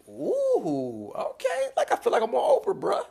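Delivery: high, loud, fast mock-awed reaction; squealing at a heavy question; amused high exclaim. Real, not acted. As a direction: react like you're suddenly on Oprah.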